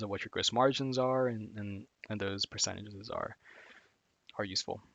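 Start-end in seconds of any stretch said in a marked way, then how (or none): none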